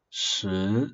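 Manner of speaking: shi said with a rising second tone. Shown as a demonstration. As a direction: lift Shi upward like a question. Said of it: "second accent, which is kind of like a rising sound".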